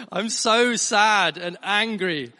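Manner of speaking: hammed-up tantrum cry; imitated shouting. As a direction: draw it out like a child's dramatic complaint. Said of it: "she's shouting at the top of her voice".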